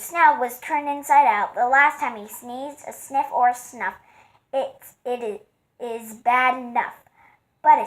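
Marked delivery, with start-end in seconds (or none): none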